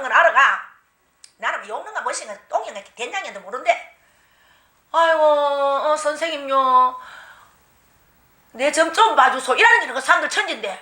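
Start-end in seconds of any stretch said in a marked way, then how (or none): 4.9-9.5 pleading villager. Retold or imitated. Villagers beg the new shaman.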